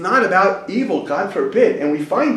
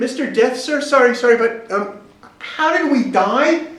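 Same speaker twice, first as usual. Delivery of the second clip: high, hesitant voice of a puzzled wife; apologetic wife; 8 semitones higher than usual. Retold or imitated. Dinner wife asking Mister Death, high and apologetic. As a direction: pipe up timidly, high, slowing on the question. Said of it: "And the wife yells out".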